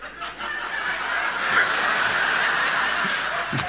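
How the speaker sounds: high airy laugh; big laugh. Retold or imitated. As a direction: wheeze with laughter. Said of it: "he started laughing".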